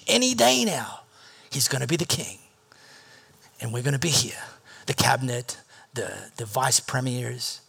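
low hushed whisper of secret ambition; hushed dreaming. Retold or imitated. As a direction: lean in and breathe it like a secret. Disciples dreaming of power, hushed.

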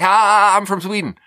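high, loud startled "Ah" then a quick blurt; panicked blurt. Retold or imitated. Nervous immigrant blurting to a stripper, high and panicky. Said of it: "state of panic to just be like".

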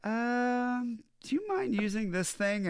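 high, tentative imitation of a girlfriend; a drawn-out high "um", then a careful request; hesitant girlfriend. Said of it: "if my girlfriend was just like".

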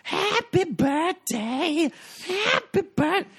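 very high, slow, drawn-out party cheer; sing-song wasted whoop; drunk partier cheering. Retold or imitated. Bartender imitating drunk birthday guests, high cheering. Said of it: "And just".

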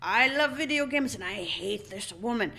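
slow theatrical villain menace. Acted. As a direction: drawl it like a cartoon villain. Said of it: "they didn't talk in a villain voice".

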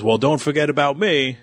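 imitated Scottish accent. God Set butting in at the coronation, lightly imitated Scottish accent. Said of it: "In his Scottish accent".

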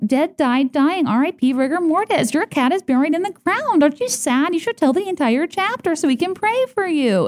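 high, overblown mock listing of death words; mock dramatic announcer. Mocking the girl who announced the cat's death, high overblown voice. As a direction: parody the girl hammering home the tragedy. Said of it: "it was just like".

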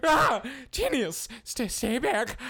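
high yelp into stammered warning; shriek, then warn off the attacker; startled alarm. Acted. Wizard surprised by enemy in cave, alarmed.

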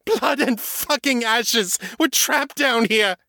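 very high, fast panicked gabble; vampire in full panic telling Kate the news; panicked rush. Acted. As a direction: spill it out in terror. Morgan in panic.

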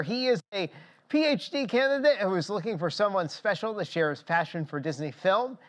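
normal talking voice

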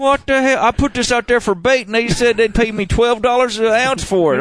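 high, hammed-up North Carolina country accent; Bubba from North Carolina. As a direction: scoff like a baffled Southern bait seller. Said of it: "Bubba from North Carolina".

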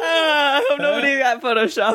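talking through a laughing fit; real laughing. Real, not acted. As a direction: get it out while still cracking up. Helpless laughter at an accidental innuendo.

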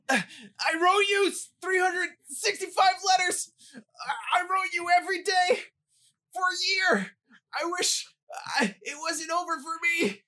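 high, strained, hammed-up agony; being eaten by snakes. [name] reads a love line as if eaten by snakes, agonised shouting. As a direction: shout it in halting bursts as snakes devour you. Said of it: "read as if you are being eaten by snakes".

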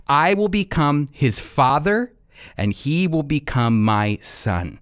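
ordinary talk, nothing marked

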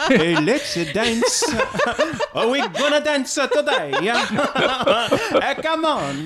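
high, laughing mock Indian accent; playful Indian accent through laughter. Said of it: "That is more Indian accent".

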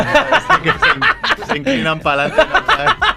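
high, laughing squeal. Real, not acted. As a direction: talk through the giggles. Laughing while describing the dance move.

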